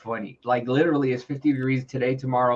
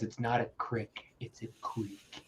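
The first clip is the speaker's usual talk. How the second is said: proper wife; 3 semitones lower, 13 dB quieter than usual. Retold or imitated. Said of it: "My wife goes".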